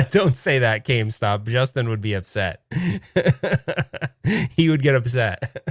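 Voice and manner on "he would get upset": laughing through the words; giggle, then keep laughing while speaking. Real, not acted. Laughing about Justin.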